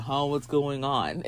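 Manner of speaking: slow, low groggy mumble; softly confused, half-awake; just woken up. Retold or imitated. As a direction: imitate a dazed guy coming to. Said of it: "the guy wakes up".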